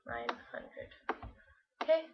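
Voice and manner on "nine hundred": whispered under the breath. Real, not acted. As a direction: whisper it to yourself. Repeats the correction under breath.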